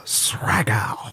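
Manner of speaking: whispered aside; whispered name. Real, not acted. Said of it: "repeating the name quietly".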